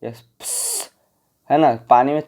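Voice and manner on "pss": a quiet pss hiss of air; hushed hissing pss; soft hiss. Shown as a demonstration. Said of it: "sound से आप क्या करते हो".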